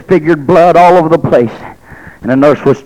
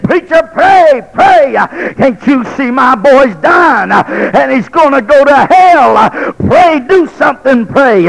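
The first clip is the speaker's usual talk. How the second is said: desperate begging; 11 semitones higher, slower than usual. Retold or imitated. Mother begs preacher to pray.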